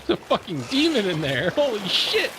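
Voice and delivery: panicked high shrieks; terrified screaming. Real, not acted. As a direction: scream in real fright. Jump scare by demon in game.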